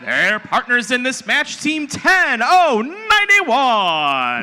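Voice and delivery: loud, slow, high hype call; fighting game announcer. Acted. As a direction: shout the team number out to the arena. Co-announcer calls team intro.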